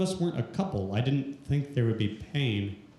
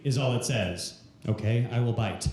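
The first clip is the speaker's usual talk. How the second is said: usual speaking voice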